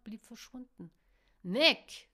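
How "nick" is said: loud, high yell of a name; sudden loud call; shouted call. A narrator's reading. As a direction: holler it across the street. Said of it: "rief Lucian".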